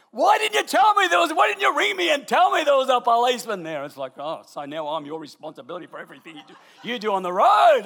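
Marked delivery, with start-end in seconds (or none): 0.1-3.8 high, loud, accusing retort from the wife; annoyed yell. Retold or imitated. Wife yelling at husband over the phone. 4.2-7.8 laughing retort. Retold or imitated. Husband's retort in the quarrel.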